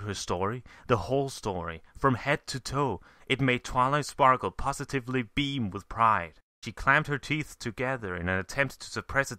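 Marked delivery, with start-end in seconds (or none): none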